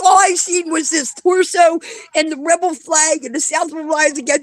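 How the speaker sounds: mock-frantic friend describing the photo; girlfriend's flustered account. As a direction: play the friend squirming in embarrassment. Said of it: "She's like".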